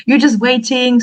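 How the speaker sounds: best friend pressing her to come, high and insistent; friend insisting. Retold or imitated. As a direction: talk her into it, high and pushy. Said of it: "I was like".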